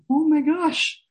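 high, thrilled oh my gosh; excited gasp. Retold or imitated. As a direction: gasp it like great news is coming. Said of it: "And I got really excited".

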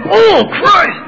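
high, loud mock dismay; loud, high groan of disbelief; exasperated exclamation. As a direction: cry it out in embarrassed disbelief. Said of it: "I went".

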